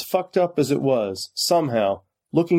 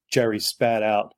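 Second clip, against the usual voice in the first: plain reading voice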